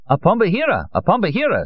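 high-pitched delighted cry; excited shouting. Acted. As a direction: call it out high over a crowd. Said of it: "some shouted".

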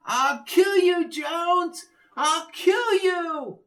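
very high, slow slurred threat; slurred angry shout. A narrator's reading. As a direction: scream the threat, drunk with rage. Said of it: "Dumas's slurry voice".

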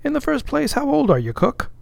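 high-pitched, mock-formal questioning; officer playing the examiner; teasing second mate. A narrator's reading. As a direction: quiz the cook with swooping pitch. Stubb questions the cook.

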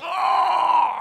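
high, loud imitated cry of someone fighting tears; hammed-up ugly trying not to cry; mock held-in wail. Preacher mimics wife at the gate, mock wailing. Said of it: "ugly trying not to cry".

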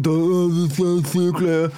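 slow, slurred mock mumbling; mock indistinct audience chatter; imitated crowd mumble. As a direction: drag the words out like a mumbling crowd. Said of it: "de mumler".

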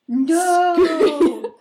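loud high shocked no; shocked cry. Real, not acted. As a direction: cry out in horror. Shocked at Yeti burning.

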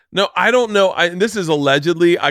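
ordinary talk, nothing marked

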